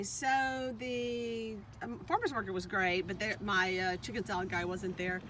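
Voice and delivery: ordinary talk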